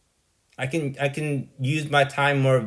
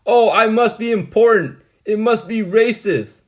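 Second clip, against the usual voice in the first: mocking Min; 4 semitones higher, 5 dB louder than usual. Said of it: "He's like".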